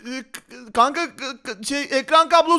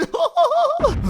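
very high cackling giggle; high-pitched troll laugh; mischievous laugh; 12 semitones higher than usual. Acted. Prankster laughs while escaping.

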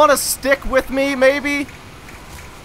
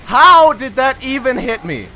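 ordinary talk, nothing marked

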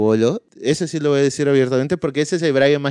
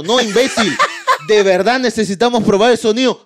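laughing insistence; 8 semitones higher, 5 dB louder than usual. Retold or imitated.

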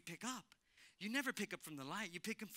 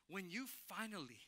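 usual speaking voice

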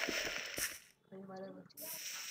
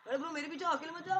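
raised, higher-pitched warning to a friend; urgent calling out; 7 semitones higher, 5 dB louder than usual. Real, not acted. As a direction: call after your partner, louder and higher. Calls after partner wandering off alone. (ऊँची आवाज़ में टोकते हुए)

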